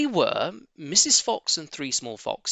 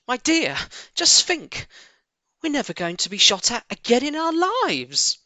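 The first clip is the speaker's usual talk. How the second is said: Rabbit; 10 semitones higher, 4 dB louder than usual. Acted. Rabbit speaks to wife.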